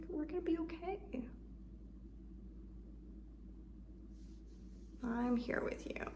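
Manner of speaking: whispered comfort; reassuring whisper. Shown as a demonstration. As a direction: whisper it close and calm. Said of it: "unless you need to hear that".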